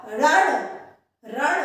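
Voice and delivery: रण with a loud, curled retroflex ण; teacher contrasting it with the cricket word; retroflex ran. Shown as a demonstration. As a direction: push the retroflex ण in रण. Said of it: "पर ये रण है".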